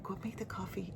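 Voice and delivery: soft, near-whispered nudge; quiet urging. Retold or imitated. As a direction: say it under your breath. First, gentler take of command.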